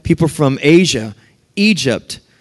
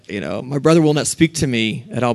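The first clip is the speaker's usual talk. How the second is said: normal talking voice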